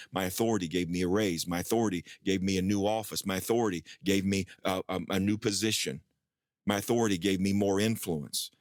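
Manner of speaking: ordinary delivery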